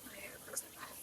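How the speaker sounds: unvoiced whisper. Real, not acted. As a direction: whisper it self-consciously. Quiet morning aside.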